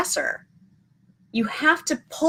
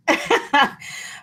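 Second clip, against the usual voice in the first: breathy self-conscious laugh; embarrassed laugh; 5 semitones higher than usual. Real, not acted. Vlogger laughing at her failed accent, embarrassed chuckle. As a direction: laugh at her own geekiness. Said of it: "There's the geek part of me".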